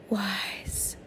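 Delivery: breathy whisper of the word. Shown as a demonstration. Showing viewers the silent word, whispered. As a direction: whisper it to yourself. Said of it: "say to yourself".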